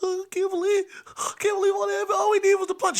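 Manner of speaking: imitating a scared kid brother; shaky panic. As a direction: fret nervously, voice way up high. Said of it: "Cavell all shaking and everything".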